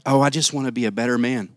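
mock pious.

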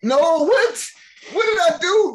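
high, loud, indignant protest; squeaky disbelieving what did I do; indignant disbelief. Real, not acted. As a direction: sound outraged at the blame. Mock outrage at being blamed.

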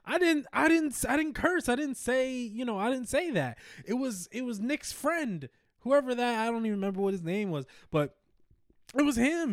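high, earnest pleading; pleading innocence. Retold or imitated. Kid pleading with mom he didn't curse, high pleading voice. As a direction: beg her to believe you.

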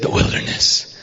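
eerie unvoiced whisper of the word; spooky whispered wilderness. Preacher imitating a spooky whisper to the church. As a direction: whisper it like a haunted place. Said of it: "He said it that way every time".

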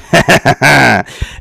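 loud burst of chuckling; real, loud laughter. Real, not acted. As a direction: laugh hard at your own remark. Laughs at his own remark.

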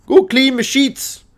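high, slow exaggerated Canadian; hammed-up high-pitched Canadian accent. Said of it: "I've done a Canadian accent".

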